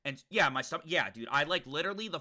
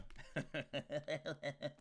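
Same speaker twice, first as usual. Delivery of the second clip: soft laugh; 12 dB quieter than usual. Real, not acted. Laughing at own song.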